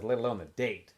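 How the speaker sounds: plain reading voice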